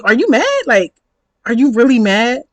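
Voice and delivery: acted-out amused challenge; mocking disbelief. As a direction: needle them with rising disbelief. Acted retort to being blocked.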